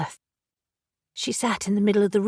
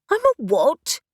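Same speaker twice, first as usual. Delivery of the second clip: stunned disbelief; 7 semitones higher, 4 dB louder than usual. Acted. Elle stunned by news.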